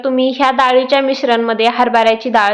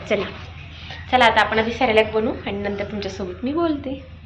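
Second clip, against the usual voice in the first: normal talking voice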